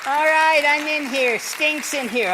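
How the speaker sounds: ventriloquist's puppet moaning about the smell; loud high puppet complaining. Acted. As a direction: puppet griping from the suitcase. Puppet inside the suitcase.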